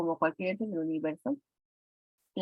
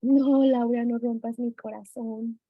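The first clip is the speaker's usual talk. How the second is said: mock heartbreak; 3 semitones higher, 5 dB louder than usual. Teacher mock-lamenting student's wrong answer.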